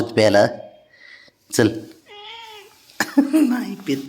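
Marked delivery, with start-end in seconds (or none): 3.2-4.0 high laugh into the words. Real, not acted. Owner laughing that pet won't drink, high laugh. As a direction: laugh it out in disbelief.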